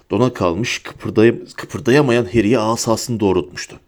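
ordinary delivery

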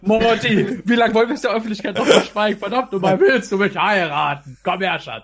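playfully woo him with a put-on voice; mock proposal. [name] mock-proposing to Morty on air, hammed-up romantic.

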